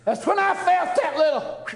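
high and loud, laughing with joy; a preacher's happy cry; joyful laughing exclamation. Real, not acted. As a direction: burst out in glad delight.

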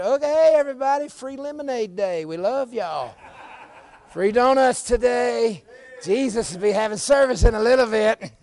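high, loud, hammed-up glad welcome; mock cheery announcement. Preacher mimicking disciples welcoming everybody, mock cheery holler. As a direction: call out a sham giveaway to the crowd.

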